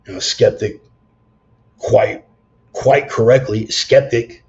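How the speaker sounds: emphatic. Real, not acted. Telling listeners about skeptics, loud and emphatic. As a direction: lean hard into skeptic. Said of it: "emphatic build to skeptic".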